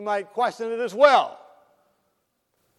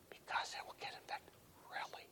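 very soft whispered exchange; whispering; 25 dB quieter than usual. Retold or imitated. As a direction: whisper it, barely audible. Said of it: "we begin whispering to each other".

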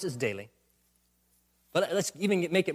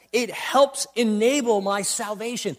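usual speaking voice

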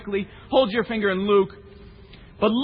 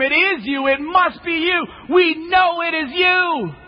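insistent accusation; 7 semitones higher, slower than usual. Acted. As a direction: press the charge home. Crowd insists it is Peter.

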